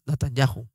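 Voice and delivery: NATANYAHU with a gentle J in "-YA-ju"; very soft J. Shown as a demonstration. Teacher illustrating the very soft J in Natanyahu, soft J. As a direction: let a light friction separate A and U in "Natanyahu". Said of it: "es una J muy suave".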